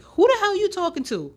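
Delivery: loud, high sassy comeback; sassy retort. Retold or imitated. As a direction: snap back with sass. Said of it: "Like, I was sassy".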